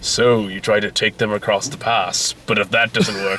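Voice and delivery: low, slow villain's taunt; ominous villain. Retold or imitated. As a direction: intone it slowly like a film villain. Retelling a villain's ominous line.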